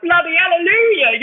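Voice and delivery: high, exclaiming hallelujah; mock relief. Therapist reacting to news he's the one, high and exclaiming. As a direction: cheer it out like overdue relief. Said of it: "she was like".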